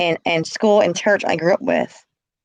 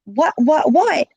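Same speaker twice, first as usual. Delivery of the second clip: rising, higher-pitched alarmed questioning; retold alarm; 6 semitones higher, slower than usual. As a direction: act out growing alarm on the phone. Retold alarmed phone call.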